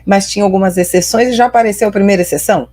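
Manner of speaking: ordinary delivery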